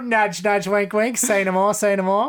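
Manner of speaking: high, loud cockney nudge-nudge voice; hammed-up cockney, wink wink; cockney accent. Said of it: "I could just do a Cockney voice".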